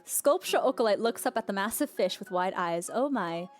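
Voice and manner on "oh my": ordinary delivery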